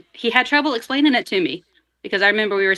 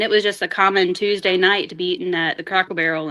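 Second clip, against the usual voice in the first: ordinary delivery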